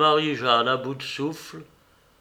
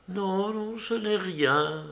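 plus aigu, lent, larmes refoulées; elle ravale ses larmes; larmes retenues; 7 semitones higher, slower than usual. A narrator's reading. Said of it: "en refoulant ses larmes". (voix qui retient les larmes)

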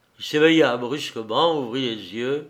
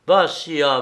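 loud, high call of Vassia; cry out the name; 4 semitones higher, 8 dB louder than usual. A narrator's reading. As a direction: shout Vassia in alarm. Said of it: "s'écria-t-il".

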